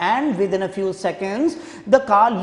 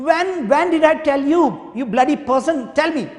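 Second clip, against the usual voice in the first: bursting with anger; 6 semitones higher, faster than usual. Acted. Governor bursting with rage at the officer. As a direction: burst out at him. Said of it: "He was bursting now".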